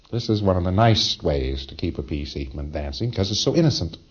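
ordinary delivery

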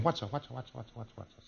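very soft, rapid repetition fading away; hushed looping question, dying off; searching inwardly. Acted. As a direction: act out fumbling to yourself. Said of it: "He's saying".

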